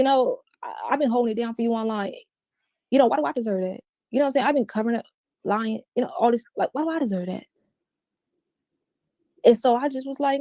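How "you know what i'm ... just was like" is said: usual speaking voice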